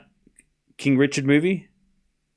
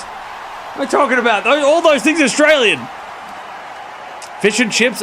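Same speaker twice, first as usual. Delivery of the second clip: indignant shout; 12 semitones higher than usual. Real, not acted. Streamer argues all those foods are Australian, indignant shout. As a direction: shout it in outraged protest.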